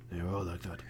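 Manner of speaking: low, soft, world-weary Boris. Retold or imitated. Boris answering Legasov about the miners, low and resigned.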